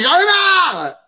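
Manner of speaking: loud high cry of frustration; fed up outburst. Retold or imitated. Student storms off, high loud fed-up cry. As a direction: blow up in exasperation. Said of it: "Il est dans tous ses états".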